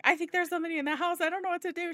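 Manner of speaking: high, fast, frightened rush; a teen calling mom about an intruder, panicked; retold panic. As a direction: blurt it out scared on the phone. Panicked phone call to mom.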